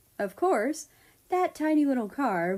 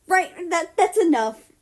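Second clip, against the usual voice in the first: high, much louder squeal to cut him off; embarrassed squeal; 10 semitones higher, 10 dB louder than usual. A narrator's reading. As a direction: squeal to drown out the reading. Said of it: "Japan squealed".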